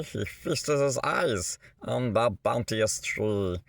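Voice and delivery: usual speaking voice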